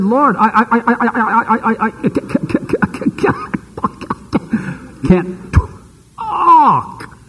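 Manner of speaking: stammering over and over on I; stuttering. Retold or imitated. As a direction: get stuck on I, then force out can't talk with a cry. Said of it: "Moses stuttered".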